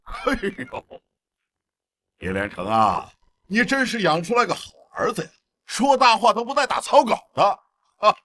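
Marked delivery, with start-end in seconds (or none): 3.5-7.4 high-pitched sarcastic praise; mock the father with scornful glee; jeering. Jeers at the son's claim.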